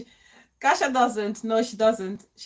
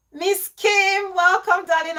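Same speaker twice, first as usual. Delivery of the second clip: excited; 8 semitones higher, 5 dB louder than usual. Real, not acted. Greeting new viewer excitedly.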